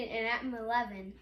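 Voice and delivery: ordinary delivery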